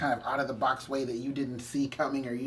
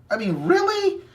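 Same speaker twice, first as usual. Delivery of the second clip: incredulous; 13 semitones higher, 5 dB louder than usual. Real, not acted. Disbelief at 444 views.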